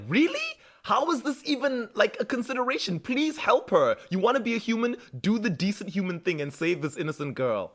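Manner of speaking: high-pitched, exasperated appeal; mock-indignant begging; incredulous pleading. [name] begs Rebecca to save an innocent girl, incredulous pleading.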